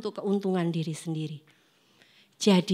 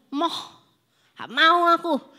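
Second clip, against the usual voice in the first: sulky refusal; 6 semitones higher, slower than usual. Retold or imitated. Sulking youth refusing.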